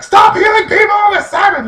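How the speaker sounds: high, loud scolding yell; shrieked imitation of angry objectors; mock outraged shout. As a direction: shout them down like an outraged crowd. Acted outraged Pharisees yelling at Jesus.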